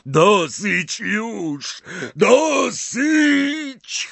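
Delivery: slow, high, loud cry of torment; anguished shout. A narrator's reading. Dumbledore in agony crying enough, anguished shout. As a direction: scream it as if burning inside.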